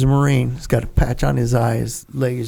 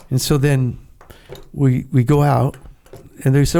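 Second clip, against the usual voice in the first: ordinary talk, nothing marked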